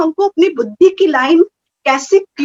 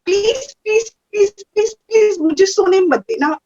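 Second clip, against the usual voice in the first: higher, near-crying plea; tearful pleading; 5 semitones higher than usual. Retold or imitated. In tension, begging Baba not to let her sleep, near tears. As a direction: beg Baba with a catch of tears. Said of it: "बहुत tension हो गया".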